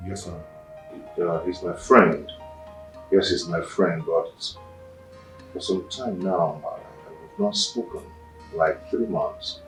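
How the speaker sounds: timid reply. Acted. Nervous subordinate answering boss.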